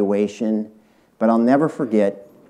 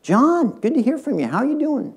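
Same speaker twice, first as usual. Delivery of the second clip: much higher, bright and friendly phone greeting; cheerful female investor; 14 semitones higher than usual. Retold or imitated. Venture capitalist answering John's call, much higher and cheerful. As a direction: greet him sweetly and high, like picking up a happy call. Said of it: "she picks up the phone and she said".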